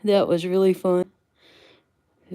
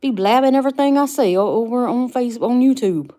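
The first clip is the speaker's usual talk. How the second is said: higher and louder, hamming up the grievance; mock angry complaint; 5 semitones higher, 5 dB louder than usual. Streamer to chat, mock-scolding her friend for blabbing secrets. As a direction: play-act being cross about blabbed secrets. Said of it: "I said".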